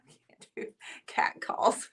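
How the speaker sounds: breathless whisper, choked with laughter; helpless laughter. Real, not acted. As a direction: slowly wheeze it out while laughing. Repeats friend's line through laughter.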